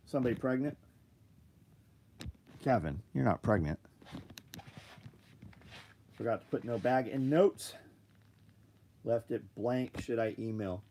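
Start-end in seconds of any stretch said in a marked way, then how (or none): none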